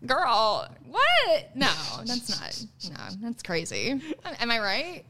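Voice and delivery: exaggerated California girl, swooping high and drawling; hammed-up California girl disbelief. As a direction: act scandalised as a California valley girl.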